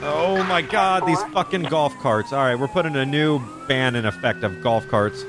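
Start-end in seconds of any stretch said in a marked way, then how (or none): 0.0-2.3 fed-up groan at traffic; slow, drawn-out exasperation; exasperated. Real, not acted. Annoyed at golf carts blocking.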